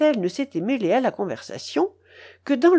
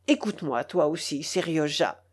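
quiet aside; 7 semitones lower, 6 dB quieter than usual. A narrator's reading. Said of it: "ajouta-t-il en se tournant vers moi".

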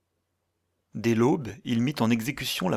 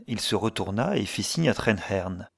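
ordinary delivery, nothing marked

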